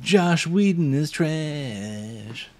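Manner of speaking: drawn-out high mocking declaration; slow mock verdict. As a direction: stretch every word out slowly.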